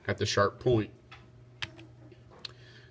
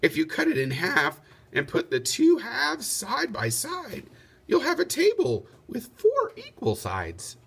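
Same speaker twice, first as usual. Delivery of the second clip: Lady Di's voice; 10 semitones higher than usual. Acted. Lady Di explains the drawing.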